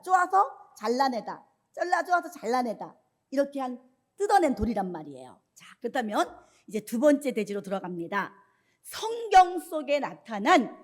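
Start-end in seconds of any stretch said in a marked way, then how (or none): none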